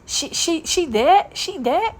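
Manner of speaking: high, shocked gossiping classmate voice, lightly put on; mocking classmates. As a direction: play a nosy former classmate gasping in disbelief. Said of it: "They gonna be like".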